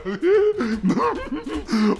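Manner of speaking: high, excited giggling; acted childish cackle; giddy boy's laugh. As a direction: laugh like an overexcited little kid. Voicing the little boy running down.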